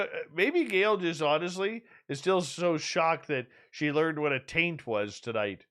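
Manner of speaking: ordinary delivery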